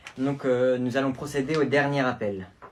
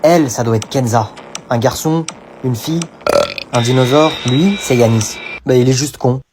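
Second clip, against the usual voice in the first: grave et puissante, façon trailer; voix de bande-annonce; 2 semitones lower, 12 dB louder than usual. Acted. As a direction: annoncer comme un film d'action. (voix off de film qui présente la classe)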